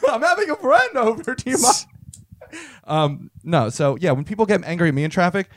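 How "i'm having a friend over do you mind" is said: high-pitched put-on protest; hammed-up polite outrage; mock indignant suspect. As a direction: play it affronted, voice pitched up. Acted suspect shooing away intruder.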